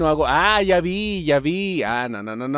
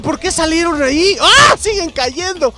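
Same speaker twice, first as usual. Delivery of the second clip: high, loud disbelieving questions; can't believe what he's seeing; real astonished outburst; 9 semitones higher, 5 dB louder than usual. Real, not acted. Players suddenly fly off.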